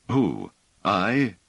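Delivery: a startled little question; flustered surprise. A narrator's reading. Pellerat taken aback when Bliss names him, flustered. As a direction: caught off guard, faintly embarrassed. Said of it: "turning faintly pink".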